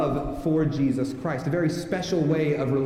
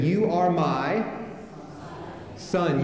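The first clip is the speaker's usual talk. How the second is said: slow, drawn out, then a long pause before son; slow prompt waiting for the congregation; fill-in-the-blank prompt; much slower than usual. Shown as a demonstration. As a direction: leave a gap for the crowd to fill. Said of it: "fill in the blanks as I go".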